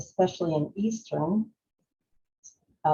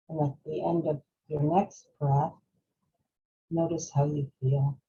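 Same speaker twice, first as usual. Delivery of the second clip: guided breathing meditation; 4 dB quieter than usual. Real, not acted. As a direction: lead them gently through each breath, unhurried. Leads a breathing exercise.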